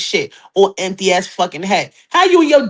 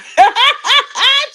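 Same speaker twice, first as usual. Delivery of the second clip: high laughing burst; real cackle; 14 semitones higher than usual. Real, not acted. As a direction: crack up after the bit. Laughs at her own accent.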